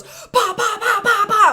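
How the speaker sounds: high popping shots; gunshot imitation. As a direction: pop them out like gunfire.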